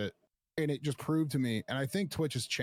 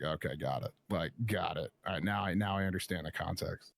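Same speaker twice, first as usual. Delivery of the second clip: resigned deadpan; 6 semitones lower than usual. Retold or imitated. Telling the guard he finally gets it, lower resigned deadpan.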